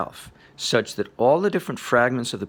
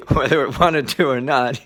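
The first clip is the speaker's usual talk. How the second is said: usual speaking voice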